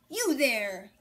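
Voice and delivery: a loud, gruff growl at the rooster; mock-menacing growl of an old grump; growling mayor. A narrator's reading. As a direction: bark it out like a cranky mayor. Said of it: "growls Don Pepe".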